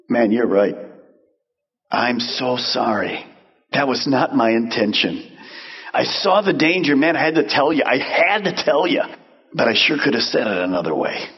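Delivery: humble apology. Pretend. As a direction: apologize gently and mean it. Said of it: "is gonna say this".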